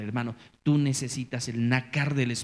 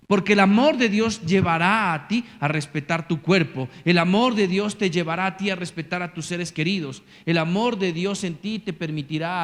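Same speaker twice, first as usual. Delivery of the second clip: preaching at full force; 3 semitones higher, 5 dB louder than usual. Real, not acted. Pastor to his flock, loud rising preaching. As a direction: proclaim it to the whole congregation.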